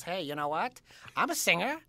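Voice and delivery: put-on voice of an odd school friend; lightly voiced wannabe Texan. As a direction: mimic the friend introducing himself. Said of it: "He talked like this".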